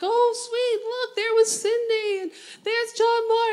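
high, squealing delight at hometown faces. Retold or imitated. Comic reliving spotting hometown fans, squealing with delight. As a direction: gush like spotting old friends. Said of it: "I'm like".

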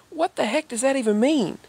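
baffled cashier. Retold or imitated. Cashier's baffled outburst retold.

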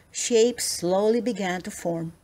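plain reading voice

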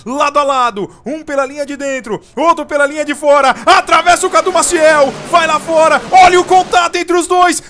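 high, loud finish-line yell; shouted race call. Real, not acted. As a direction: scream the photo finish.

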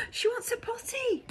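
very high alarmed cry. Acted. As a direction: call it out in panic. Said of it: "cried the gardener".

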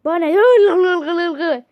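loud, slow Bana ne; loud dismissive. Pretend. Snapping at the chatbot dismissively.

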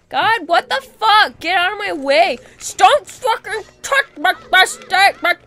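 high, loud frantic rant; exasperated yelling. Real, not acted. Player cursing enemy in the way, exasperated high yelling. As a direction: snap in heated frustration.

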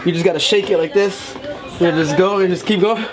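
ordinary delivery

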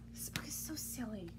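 soft whispered complaint; whispered aside. Real, not acted. Reader complaining to the kids, whispered. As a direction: confide it under your breath.

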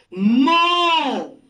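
"Mo" held long for learners; sílaba modelo. Shown as a demonstration. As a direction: stretch the vowel of "Mo". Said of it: "me da como sílaba". ("Mo" alargado, como modelo)

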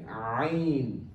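Ain opened with the throat sound, drawn out; careful Ain. Shown as a demonstration. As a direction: model AIN slowly from the throat. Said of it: "Just listen carefully".

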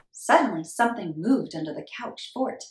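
plain reading voice

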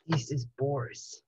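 low, soft stranger's voice; male voice. A narrator's reading. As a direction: speak low as a mysterious man. Boris speaks on the phone.

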